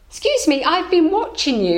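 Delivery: a curious merchant hailing a stranger; lightly voiced shopkeeper.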